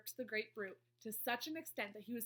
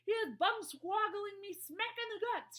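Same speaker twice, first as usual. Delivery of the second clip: high, loud, stretched-out complaint; shout; 11 semitones higher, 9 dB louder than usual. A narrator's reading. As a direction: shout it like an injured giant. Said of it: "shouted the gizzard gulp".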